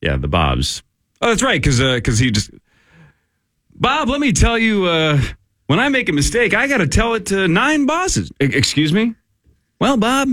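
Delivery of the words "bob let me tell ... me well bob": high-pitched film character voice; movie-quote impression. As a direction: play the put-upon office worker, pitched up. Acts out lines from Office Space.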